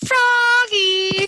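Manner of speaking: high, loud call across the house; mother calling out. Acted. Mother calling after Froggy, loud high shout. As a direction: shout his name from the other room. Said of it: "called his mother".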